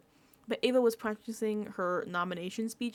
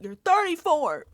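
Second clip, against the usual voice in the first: loud, slow, higher-pitched telling-off; exasperated scolding; 6 semitones higher, 8 dB louder than usual. Acted. Telling Vince to stand up, loud exasperated scolding. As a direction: hit it hard, fed up.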